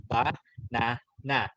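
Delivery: "Ba, na, na" split into separate beats; syllable by syllable. Shown as a demonstration. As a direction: clap out each syllable of banana. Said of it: "How many syllables do you have?".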